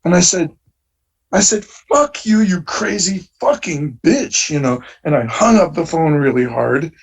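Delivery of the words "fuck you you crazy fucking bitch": high, exasperated mock yell, slowing on the insult; retold angry curse. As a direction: snap back at the caller in disbelief. Cursing caller before hanging up.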